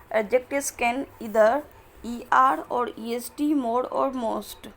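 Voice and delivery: slow, careful reading of the English rule; slow textbook reading. Acted. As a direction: read each word out slowly for students. Reads the English textbook rule aloud. (धीमे-धीमे किताब से पढ़ना)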